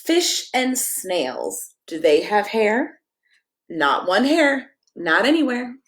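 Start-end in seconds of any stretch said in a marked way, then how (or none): none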